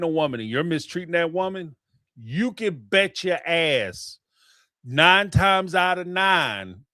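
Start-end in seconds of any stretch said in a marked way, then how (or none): 2.2-6.8 each word drawn out slowly; slow and deliberate for emphasis; slow emphatic. Real, not acted. Emphatic point.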